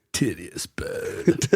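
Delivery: slow, low surfer drawl. Shown as a demonstration. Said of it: "in the surfer voice".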